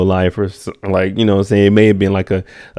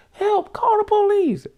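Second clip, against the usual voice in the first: mock panicked plea; slower than usual. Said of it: "She like".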